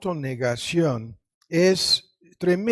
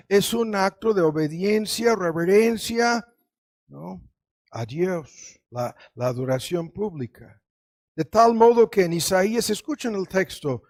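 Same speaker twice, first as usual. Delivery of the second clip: ordinary delivery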